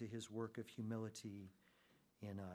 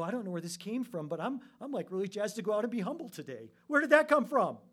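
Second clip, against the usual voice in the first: rising, fast, jazzed-up voice; surprised eagerness; 9 semitones higher, 11 dB louder, faster than usual. Acted. Believers surprised by their own eagerness to be humble, excited and astonished. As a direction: burst out higher, ending in a loud amazed cry. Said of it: "And we go".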